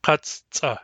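Katsta split in two, kats, pause, tsa; by syllables. Shown as a demonstration. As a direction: release both C's in Katsta, with a gap between syllables. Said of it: "When we pronounce them by syllables".

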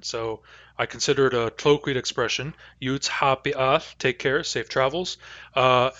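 usual speaking voice

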